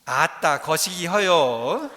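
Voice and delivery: slow, loud Jeolla dialect drawl; 느리고 큰 소리의 전라도 사투리. Shown as a demonstration. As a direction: say it in hearty Jeolla dialect, stretched out. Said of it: "전라도 사투리로 뭐라고 할까요?".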